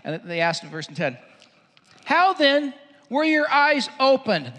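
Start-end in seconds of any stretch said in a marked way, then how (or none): 2.0-4.5 slow, raised, insistent question; demanding. Acted. Pharisees question the healed man, demanding. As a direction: demand an answer, high and loud. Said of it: "They demanded".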